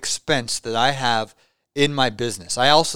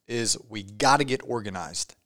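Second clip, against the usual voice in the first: ordinary delivery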